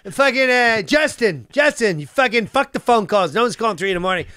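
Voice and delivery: high, rapid-fire mock badgering; imitated pushy castmate. Castmate rounding up players at night, mock insistent yelling. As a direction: boss everyone into the game, as the castmate. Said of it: "he like".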